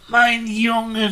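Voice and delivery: high, relieved motherly cry; mother calling out. Acted. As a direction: call to your found child. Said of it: "rief die Mutti". (Mutter, die erleichtert ruft)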